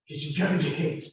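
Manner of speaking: ordinary talk, nothing marked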